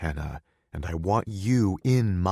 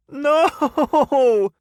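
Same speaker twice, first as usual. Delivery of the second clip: giggling; 12 dB louder than usual. Acted. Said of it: "she giggles as I tickle her".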